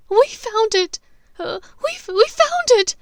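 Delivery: high, loud sobbing plea. Acted. Hermione sobbing her answer to Bellatrix. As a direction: force the words out between sobs. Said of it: "punctuated by exaggerated sobs".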